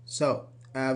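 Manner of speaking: usual speaking voice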